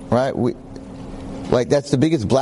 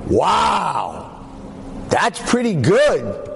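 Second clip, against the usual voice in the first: impressed Mike; 6 semitones higher, much slower than usual. Retold or imitated. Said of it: "Mike's like".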